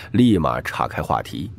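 normal talking voice

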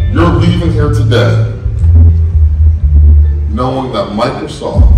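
big, loud stage speech; rousing keynote. Real, not acted. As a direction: fire up the whole room. Keynote speech to sales crowd.

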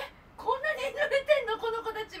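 high, loud amazed question; mock onlooker surprise. Imitating onlookers staring at the soaked friends. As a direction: gawk at the wet girls.

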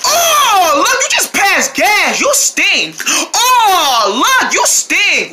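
high-pitched, drawn-out revulsion; disgusted outcry. Acted. Disgusted outcry at passed gas.

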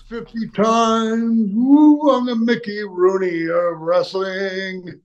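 high, drawn-out wrestler brag with a big woo; Ric Flair. Acted. Wrestler mimics colleague bragging about marriages, high whooping boast. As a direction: boast slowly and high, whooping mid-speech.

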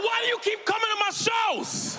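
exasperated shout. Retold or imitated. Comic at the cancer lady, high exasperated yell. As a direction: throw up your hands and yell it.

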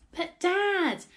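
high, loud, drawn-out whine of protest; exasperated child's call, pitched high; pleading protest. A narrator's reading. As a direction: plead to be heard. Boy trying to be heard.

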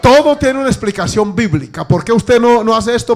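loud, high preaching; fiery sermon delivery; preaching at full force. Real, not acted. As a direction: preach it out to the congregation.

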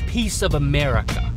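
ordinary talk, nothing marked

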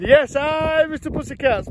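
squeaky, high catchphrase; playful high squeak; high-pitched sign-off. Pretend. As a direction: pitch it way up like a cat.

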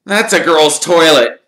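loud, high-pitched protest; gruff. A narrator's reading. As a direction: object gruffly and loudly. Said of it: "said Ron gruffly".

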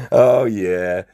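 ordinary delivery

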